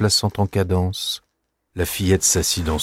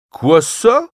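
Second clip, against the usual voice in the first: high, louder mock surprise; playful uncle; 11 semitones higher, 5 dB louder than usual. Uncle makes light of the broken toy, mock surprised. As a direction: shrug it off playfully.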